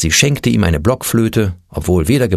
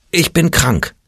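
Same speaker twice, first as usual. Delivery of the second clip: ordinary delivery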